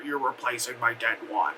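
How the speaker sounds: low-pitched, lightly creepy mock voice. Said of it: "in, like, a creepy, creepy way".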